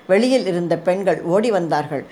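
plain reading voice

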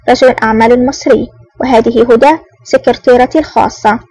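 ordinary delivery, nothing marked

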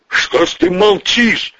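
high, slow demand; angry snarl. Acted. Vetryakov demands why he kept silent, snarling. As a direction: growl out the reproach. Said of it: "зарычал Ветряков".

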